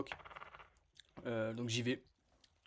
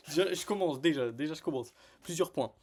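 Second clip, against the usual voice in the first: laughing; 4 semitones higher, 6 dB louder than usual. Retold or imitated. Replaying his speech to bosses, laughing.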